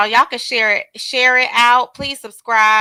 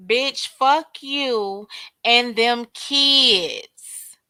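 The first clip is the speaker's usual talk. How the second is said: slow dramatic read; much slower than usual. Retold or imitated. Re-reads shocking message slowly.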